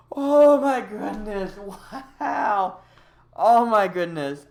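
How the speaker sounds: slow, loud, drawn-out exclaiming; high voice of disbelief, settling gradually; stunned amazement. Real, not acted. Streamer taking in the win, stunned amazement.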